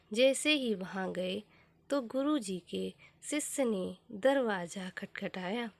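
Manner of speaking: usual speaking voice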